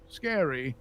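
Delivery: ironic scary in air quotes. Real, not acted. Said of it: "air quotes".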